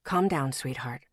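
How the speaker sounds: low, soft male voice; lightly voiced man. A narrator's reading. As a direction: soothe her, low.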